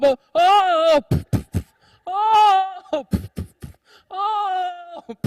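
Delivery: high, drawn-out falling wails; mock wailing. Lady wailing while tumbling in the wave, high mock wails. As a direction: wail as you tumble under.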